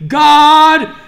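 loud, high, fervent sermon voice; preaching at full force to the congregation; fiery preaching. Acted. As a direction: drive it home to the church.

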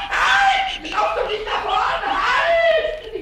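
high, loud cries to stop; panicked shouting. Acted. Pumuckl shouts after the fleeing mouse, high and panicked. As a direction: shriek after an escaping pet.

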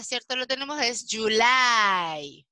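normal talking voice

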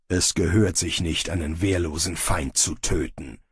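stern. Acted. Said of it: "sagte Bane streng".

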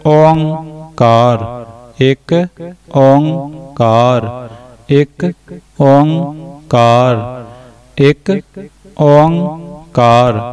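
very slow ਇੱਕ ਓਂਕਾਰ, each ਓਂਕਾਰ drawn out long; slow model recitation. Shown as a demonstration. Teacher repeating the correct form slowly for learners. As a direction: chant it slowly, stretching each Onkar. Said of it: "ਦਾ ਉਚਾਰਨ ਬਣਦਾ ਹੈ ਇੱਕ".

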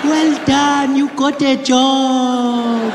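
very high, loud, drawn-out granny voice; excited grandmother. Retold or imitated. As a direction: imitate a thrilled granny stretching out each phrase. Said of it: "she was really excited".

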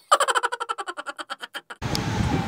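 high breathy giggle; a quick high laugh. Real, not acted. Laugh after hype shout.

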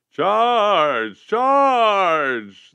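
high, loud battle cry. A narrator's reading. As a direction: scream the charge. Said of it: "the general cried".

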